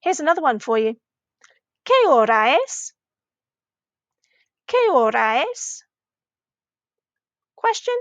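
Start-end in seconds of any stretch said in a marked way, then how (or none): 1.9-2.9 "Qué hora es" dipping in the middle, then swooping up on "es"; "Qué hora es" as an exaggerated question; rising question. Shown as a demonstration. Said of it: "that was another question". 4.7-5.8 "Qué hora es" dipping in the middle, then swooping up on "es"; "Qué hora es" as an exaggerated question; rising question. Shown as a demonstration. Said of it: "that was another question".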